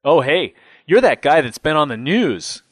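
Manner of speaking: high, loud, thrilled recognition; as if just plugged in; lit-up excitement. A narrator's reading. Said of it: "His eyes lit up".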